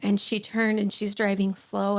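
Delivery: normal talking voice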